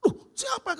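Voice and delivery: high, sharp angry question; angry boss. Retold or imitated. Boss angrily demands a name on the phone. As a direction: bark the question like an upset boss. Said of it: "Udah marah-marah kan".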